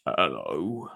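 low, soft giant's hello; gentle. Acted. Big Mister Thompson greets two children, gentle low soft voice. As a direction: drop down low and speak softly. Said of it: "he said gently".

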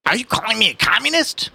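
high, indignant question; affronted policeman. Acted. Policeman asking the old man about the accusation, affronted. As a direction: challenge the insult, pitch jumping up.

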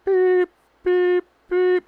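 high, beeping reverse alarm; imitated truck beep.

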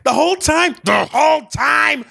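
high hysterical screaming; screaming crying fan. Acted. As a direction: shriek it through sobs. Reenacting emotional movie scene.

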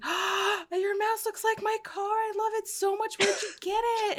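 butter him up with a bright gush; high-pitched gushing flattery; gushing sweet talk. Retold or imitated. Retold sweet-talking the mechanic.

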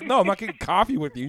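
quick, loud, mock-angry refusal; retold indignant refusal. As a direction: act out a friend refusing, rushed and high. Friend's indignant refusal acted out.

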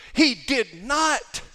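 "He did not" slow, high and loud, each word punched; emphasis on NOT. Shown as a demonstration. Preacher stressing to his congregation that Jesus did not. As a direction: lean hard on every word of "He did not". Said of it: "I say it with emphasis".

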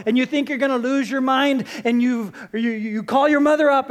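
ordinary talk, nothing marked

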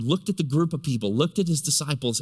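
ordinary talk

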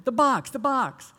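put-on high, slowed child voice insisting; the kid repeating himself impatiently; insistent child. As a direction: nephew pointing out the box. Nephew insisting in story.

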